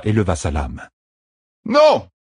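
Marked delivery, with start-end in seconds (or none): 1.6-2.1 much higher, louder cry of no. A narrator's reading. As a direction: stop him with one yell. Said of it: "s'écria Matt".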